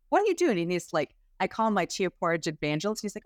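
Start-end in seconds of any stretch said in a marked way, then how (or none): 0.1-0.6 much higher and louder astonished echo; astonished repeat. Retold or imitated. Friends pressing the client again, much higher and louder in astonishment. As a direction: repeat it, now truly floored. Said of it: "Everybody's kind of going".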